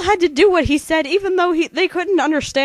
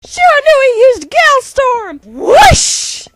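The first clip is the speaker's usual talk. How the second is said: high, loud move announcements; Pokemon battle calls; 9 semitones higher, 6 dB louder, slower than usual. Acted. Two narrators trading battle moves, announcer shouts. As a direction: call each move like a game announcer.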